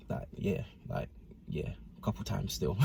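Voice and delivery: almost voiceless, dropping away to nothing; sheepish confession. Retold or imitated. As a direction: confess it under your breath. Admitting hooking up with girl.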